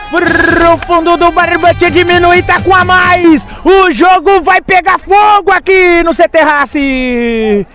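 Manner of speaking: high, slow, drawn-out goal call; explosive goal commentary. Real, not acted. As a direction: ride the goal, stretch it out. Goal celebration continues.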